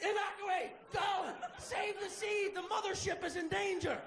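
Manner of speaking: high frantic shouted orders; alarmed shouting. Pretend. Brain ordering his body to evacuate, frantic high yells. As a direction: yell it like a ship's alarm.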